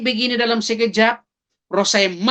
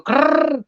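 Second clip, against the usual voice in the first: high rolled rattling noise; imitated wound rattle; 7 semitones higher than usual. Man whose voice leaks from his neck wound, imitated rattle. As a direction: mimic air escaping through a torn throat. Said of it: "suara keluar dari luka itu".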